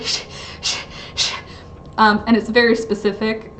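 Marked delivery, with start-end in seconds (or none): 0.0-1.3 breathy unvoiced sneezing shh sounds; soft hissed sneeze noises; dog sneeze imitation. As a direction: mimic a dog's little sneezes. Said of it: "So he goes like".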